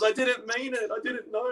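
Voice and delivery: very high, softened plea; sheepish high-pitched protest; pleading husband. Retold or imitated. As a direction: whimper an excuse in mock helplessness. Acts out his plea to wife.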